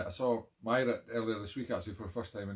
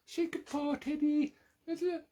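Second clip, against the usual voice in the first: second ask, much higher and sillier; squeaky dog voice. Real, not acted. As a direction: coax the paw in a squeaky voice. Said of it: "this silly voice".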